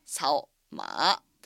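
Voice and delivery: サオマー with サオ rising, then dipping; sao ma tone demo. Shown as a demonstration. Teacher models サオマー tone sandhi clearly. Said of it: "サオは二、三、二声になって".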